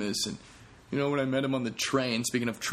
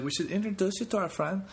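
a put-on European accent, higher; lightly imitating the girls' accent; bad accent impression; 9 semitones higher than usual. Comic quoting girls on the train, weak accent impression. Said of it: "That's not a good accent or a good impression".

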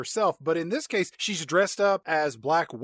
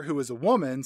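usual speaking voice

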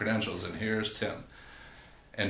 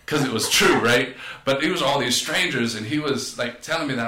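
louder, higher, worked up; heated; 4 semitones higher, 8 dB louder than usual. Real, not acted. Telling friends how the coach's truth stung, loud and higher. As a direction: relive the sting loudly.

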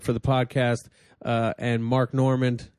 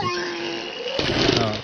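duck squawk. Pretend. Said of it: "That sounded like a duck".

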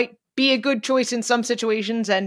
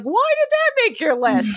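mock incredulous; 13 semitones higher than usual. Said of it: "you all shoot holes".

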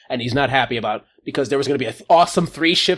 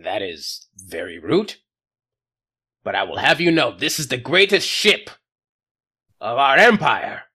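offended alien captain. Acted. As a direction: sniff with wounded pride.

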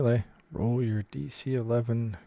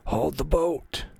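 slow, high, rasping shout across the smoke; raspy yell; 6 semitones higher, slower than usual. Acted. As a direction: drag out a hoarse, raspy call. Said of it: "a raspy voice ... yells".

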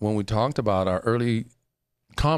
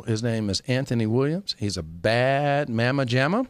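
ordinary delivery

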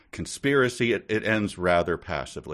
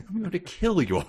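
mock king; 11 semitones higher, faster than usual. Mocking the king's threat.

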